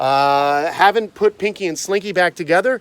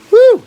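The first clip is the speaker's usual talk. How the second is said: high, loud "woo"; sharp exhale of a whoop, pitched way up; 13 dB louder than usual. Real, not acted. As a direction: let out a sudden high whoop. Reacting to the warm day.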